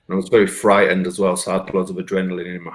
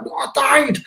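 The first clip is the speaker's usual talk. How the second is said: insistent. Retold or imitated. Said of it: "And I'm like".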